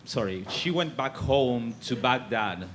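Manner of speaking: ordinary talk, nothing marked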